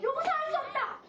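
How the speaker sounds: high-pitched outraged disbelief; shocked indignation. Acted. Young David hearing God insulted, high shocked indignation. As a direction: react aghast, voice pitched well up. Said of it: "깜짝 놀랐어요".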